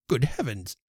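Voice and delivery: cried out. Acted. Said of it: "cried Mister Elton".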